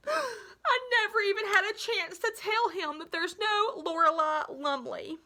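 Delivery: high, trembling near-sob; choking up on the words; tearful. A narrator's reading. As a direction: voice breaking with tears. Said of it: "She drew in a tremulous breath".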